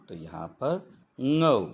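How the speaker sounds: ngou said slowly with a velar ng onset; teacher slowly models ngou; model sound ngou. Shown as a demonstration. Teacher showing learners how ngou is read, slow. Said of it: "इसे इस तरह से पढ़ेंगे".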